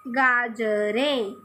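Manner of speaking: गाजरें spoken very slowly while writing, falling at the end; slow dictation. Shown as a demonstration. Teacher slowly dictating गाजरें as she writes it. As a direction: draw गाजरें out slowly, syllable by syllable. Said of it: "लिख के दिखा रही हूँ मैं".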